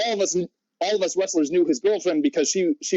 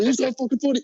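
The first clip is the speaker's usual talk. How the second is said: higher-pitched retold outburst of anger; pissed off; 9 semitones higher, faster than usual. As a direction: replay his angry protest, pitched up. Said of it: "he's super pissed".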